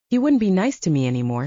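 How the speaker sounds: plain reading voice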